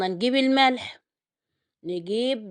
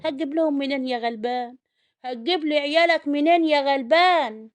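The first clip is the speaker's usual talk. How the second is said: mock lament; 6 semitones higher, 4 dB louder than usual. Cook pities the poor father of children, mock lament. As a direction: lament loudly for the poor breadwinner.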